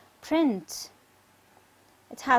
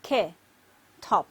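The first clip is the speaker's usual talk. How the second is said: "K, top" as two separate beats; split into syllables; 5 semitones lower than usual. Shown as a demonstration. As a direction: pause between "K" and "top". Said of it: "we divide it into two parts".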